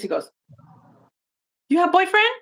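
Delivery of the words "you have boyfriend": high, put-on foreign accent, broken English; guy's odd accent. Mimicking the bushes guy's odd accent to her friend. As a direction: mimic the stranger's strange accent. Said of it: "I don't know where the fuck hi-, this accent came from".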